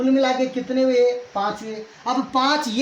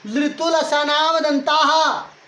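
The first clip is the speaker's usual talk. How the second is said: slow sutra recitation; 5 semitones higher, much slower than usual. Shown as a demonstration.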